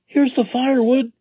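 Jack cries it out high and loud; excited shout. A narrator's reading. Jack shouts to his mother, high and loud. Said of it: "shouted Jack".

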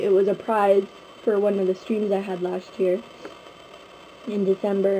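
ordinary delivery

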